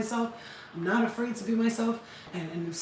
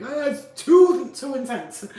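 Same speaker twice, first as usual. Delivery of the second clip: louder, higher, slow mock gasp; overwhelmed exclaim; 4 semitones higher, 9 dB louder, slower than usual. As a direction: act out being overwhelmed, half joking. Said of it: "I was always like".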